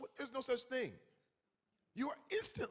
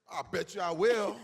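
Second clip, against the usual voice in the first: mock boast; 10 dB louder than usual. Guy answering dare.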